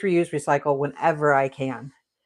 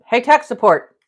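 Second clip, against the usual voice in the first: calling out louder and higher; 8 semitones higher, 11 dB louder than usual. Real, not acted. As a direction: call again, much louder and higher. Calls again, helper not answering.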